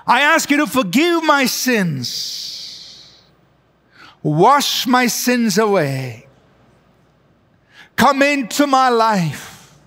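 slow, measured prayer with long pauses; leading a prayer. Acted. Preacher leads viewers in a prayer of repentance, slow and measured. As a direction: pray slowly, phrase by phrase, letting each line fall.

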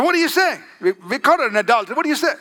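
quick, high-pitched challenge; pressing accusers. Retold or imitated. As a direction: press urgently for an answer, pitch jumping up. Accusers press Jesus for a verdict.

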